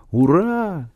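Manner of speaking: high, swooping cheer without extra volume; excited cry. A narrator's reading. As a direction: cheer with pitch soaring, voice held in. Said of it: "закричал Генка".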